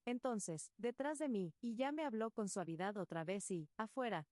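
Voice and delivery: ordinary delivery, nothing marked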